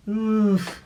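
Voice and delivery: high, loud oof; a startled grunt. A narrator's reading. Story sound effect.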